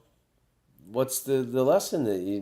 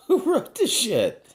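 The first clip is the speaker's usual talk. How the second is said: laughing disbelief; 11 semitones higher than usual. Retold or imitated. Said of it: "I thought it was like".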